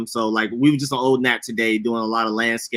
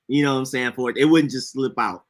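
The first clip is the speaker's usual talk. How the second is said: ordinary delivery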